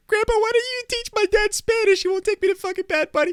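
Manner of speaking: put-on very high, squeaky kid voice; complaining kid. Kid tattles to grandpa about dad, high squeaky whine. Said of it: "he calls my dad and he's like".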